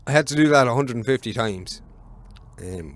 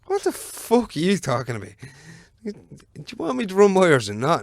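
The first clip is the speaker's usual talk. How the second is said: higher-pitched mock exasperation; retold indignation; 7 semitones higher than usual. Worker challenging the foreman about his wallet, mock indignant. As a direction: push the pitch up, acting out an affronted worker.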